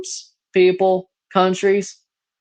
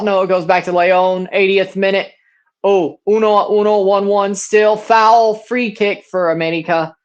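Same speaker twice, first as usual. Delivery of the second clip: live play-by-play; 3 semitones higher, 5 dB louder than usual. Acted. Streamer calling the match to viewers, excited play-by-play. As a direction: call every touch as it happens.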